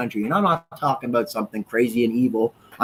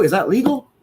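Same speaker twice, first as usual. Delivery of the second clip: ordinary talk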